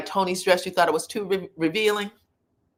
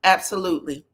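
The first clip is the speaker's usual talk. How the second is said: normal talking voice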